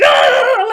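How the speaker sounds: loud mock growl of rage; angry growling yell. Mimicking Greg angrily writing his chapter, growling. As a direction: roar in exaggerated frustration. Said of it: "very angrily writing this chapter".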